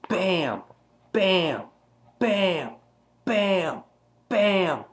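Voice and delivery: high, falling bams, evenly spaced; imitated bat blows. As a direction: hammer out each hit as a sound word.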